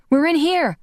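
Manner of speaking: high loud call; calling back to the rescuers through rock. Acted. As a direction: shout so they can find you. Wanda calls back to Angus.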